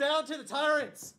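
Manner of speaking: slow, loud protest chant; rallying battle cry. Pretend. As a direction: shout it like a mob leader. Rallying cry over card.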